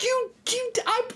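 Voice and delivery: very high, broken-off stammer; mock outraged disbelief, words failing; speechless sputter. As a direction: sputter as if too stunned to finish. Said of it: "I'm just like, I'm speechless".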